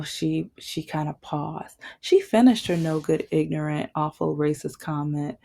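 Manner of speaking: ordinary talk, nothing marked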